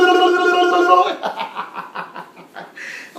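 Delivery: high, loud babbling gibberish imitation; mock speaking in tongues. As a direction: babble wordlessly, then cackle, mimicking the friend. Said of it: "she's just speaking in tongue".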